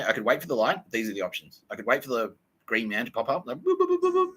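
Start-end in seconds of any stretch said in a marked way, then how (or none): 3.6-4.3 high steady boop boop like a pedestrian signal; crossing signal beeps. Retold or imitated. Storyteller mimics the pedestrian crossing signal, high beeping. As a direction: imitate the beeping green man.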